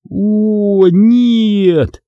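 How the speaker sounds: high, long-drawn moan of dismay; groaning. A narrator's reading. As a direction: moan it out, pitched high and stretched. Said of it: "застонал я". (протяжный высокий стон отчаяния)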